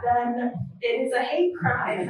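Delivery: ordinary talk, nothing marked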